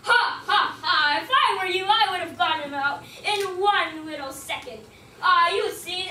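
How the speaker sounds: loud hearty mock laugh; Lion laughs at Hare's story, loud boastful laughter. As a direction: laugh it off like a proud king. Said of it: "Lion laughed".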